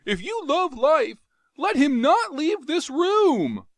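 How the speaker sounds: high, loud panicked yell; frantic scream. Acted. Jav screaming at Carthoris to stop Tario.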